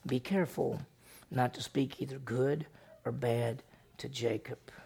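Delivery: hushed, breathy whisper of God's command; soft dream-voice warning. Acted. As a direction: whisper it like a secret from heaven.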